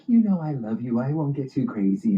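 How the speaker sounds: high, sweet-talking reassurance; sugary wife voice. Retold or imitated. Wife sweetly reassuring her husband, high and cooing. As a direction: coo it back at him. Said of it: "She's all like".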